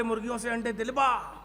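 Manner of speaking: ordinary delivery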